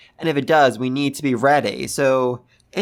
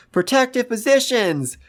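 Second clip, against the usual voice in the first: mock yell; 9 semitones higher than usual. Kawaku yells out to get them into protective positions, mock yell. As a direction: call it out high like a warning, without full volume. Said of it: "Kawaku yells out".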